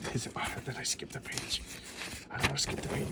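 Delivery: hushed whisper to himself; whispered aside. Real, not acted. Flipping comic pages, whispering to himself in a hushed aside. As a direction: whisper it, worried he skipped a page.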